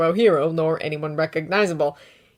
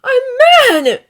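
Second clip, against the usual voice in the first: much higher and louder outburst; spat out anger; 13 dB louder than usual. A narrator's reading. Izuku bursting out at All Might in anger. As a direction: fling it out through his teeth. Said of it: "a hiss spat through his teeth".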